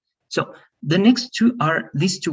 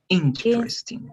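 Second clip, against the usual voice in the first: Interesting with a strong IN, slower; more stress first part; 9 semitones higher, slower than usual. Shown as a demonstration. Teacher asks Betsy for more stress on the start. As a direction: hit IN hard, slow it down. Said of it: "más énfasis en la primera parte".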